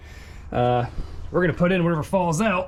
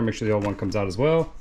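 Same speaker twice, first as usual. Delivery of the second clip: ordinary talk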